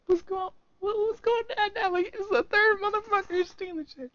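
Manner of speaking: high, mock-baffled inner voice. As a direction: act stunned by a third thief. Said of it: "watching, like".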